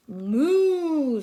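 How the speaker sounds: loud mooing; loud playful moo; cow moo. Acted. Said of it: "says the cow".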